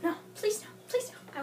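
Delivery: hushed, breathless pleading; whispered dread. Retold or imitated. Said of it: "I'm like".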